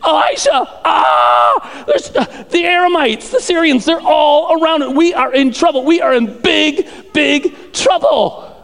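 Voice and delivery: high-pitched, hammed-up panic; acted freaking out. Percy warning Elisha of surrounding troops, hammed-up panic. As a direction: cry out in mock alarm, drawing out the trouble. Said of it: "he's freaking out".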